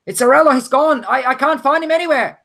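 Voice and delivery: high, loud deckhand in a panic; urgent high-pitched shout; alarmed shouting. Acted. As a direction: cry out that someone is missing.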